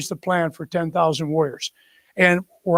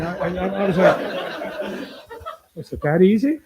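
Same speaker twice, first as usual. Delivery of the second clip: amused disbelief through a laugh; chuckling at the surprise; slower than usual. Real, not acted. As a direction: laugh while asking if it was that easy. Laughing at how easy.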